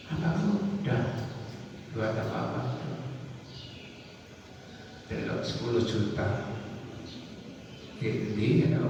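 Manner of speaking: very soft, low, confidential voice; discreet hushed giving. Acted. Preacher acting out secret giving to a woman, hushed. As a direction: slip it over quietly, just between two people.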